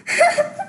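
a sudden high laugh; high burst of laughter. Real, not acted. Mother and daughter reading chat, high squealed laugh.

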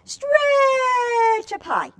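put-on stretchy high call to children; high stretched-out call. Shown as a demonstration. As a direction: stretch up high, drawn out and sung high. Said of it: "Can everybody stretch?".

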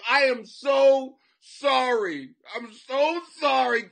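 loud, slow hammed-up sorry; mock apology. Comic apologizing before demanding money, slow mock sorry. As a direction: overdo the sincere sorry. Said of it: "Right after I apologize".

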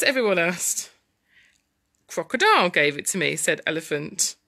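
put-on lively story narration for children; dramatic storytelling. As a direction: read the tussle with big dramatic swings.

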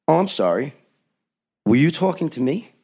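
feigned obliviousness. Retold or imitated. Director feigns not having listened.

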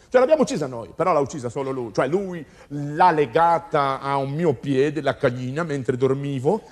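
usual speaking voice

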